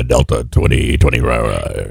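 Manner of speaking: low drawn-out cockpit radio voice; pilot voice. Retold or imitated. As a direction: drop down low like a calm airline pilot. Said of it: "his pilot voice".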